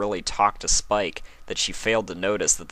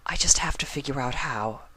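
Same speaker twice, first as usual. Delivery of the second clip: whispered, a touch higher; 7 semitones higher, 3 dB quieter than usual. A narrator's reading. Rarity confessing to Spike she must find a way, whispered. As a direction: breathe it out as a secret. Rarity confides her worry.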